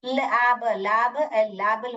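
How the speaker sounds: लैब with short "a", then लेबल as LAB-ul; wrong reading sounded out. Shown as a demonstration. Teacher sounds out the wrong division, short-vowel misreading. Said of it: "तो ये गलत होगा".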